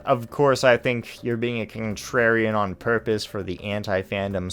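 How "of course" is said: ordinary delivery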